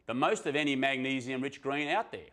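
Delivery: usual speaking voice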